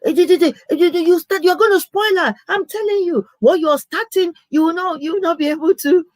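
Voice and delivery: playing the brother scolding her for spoiling the wife; mock angry brother. Brother on the phone, angry she is spoiling his wife, imitated scolding. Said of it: "he was so angry".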